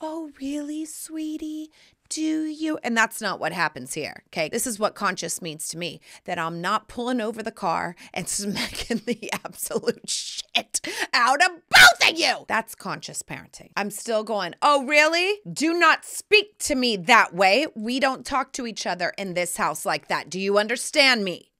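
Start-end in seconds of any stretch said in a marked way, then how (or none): 0.0-2.8 high, syrupy mock-sweet parent; mock pushover parent. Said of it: "people think that conscious parenting is". 14.6-21.5 put-on louder, stern scolding of the kids; firm stern parent. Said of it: "I'm very firm and I'm very clear".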